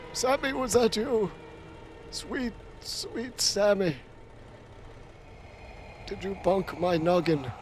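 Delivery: mock tender. Asking Sammy whether he hit him, mock tender. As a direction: coo at him like a sweet child.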